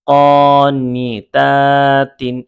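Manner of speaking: slow, level syllables with gaps; sustained teaching chant; broken into syllables. Shown as a demonstration. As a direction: build the word up piece by piece. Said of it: "উচ্চারণ করে ভেঙে ভেঙে বলতে পারেন".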